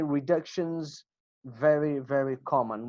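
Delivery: usual speaking voice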